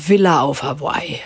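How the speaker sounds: hushed, teasing aside to the listener; joking whisper. Pretend. Teacher whispers a joking worry example to students, hushed and teasing. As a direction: whisper the punchline like a secret aside.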